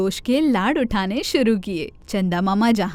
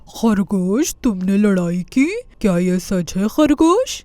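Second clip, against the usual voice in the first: deeper, slow, questioning; stern Chanda Mama; 4 semitones lower than usual. Acted. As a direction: question the rabbit gravely.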